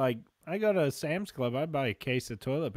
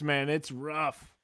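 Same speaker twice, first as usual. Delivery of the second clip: usual speaking voice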